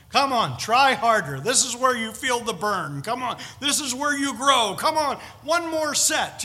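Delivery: loud, pushy spin-class instructor; pushy fitness coach. Retold or imitated. Online instructor yelling at a stationary-bike rider to push. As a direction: yell encouragement over the internet. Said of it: "people telling you".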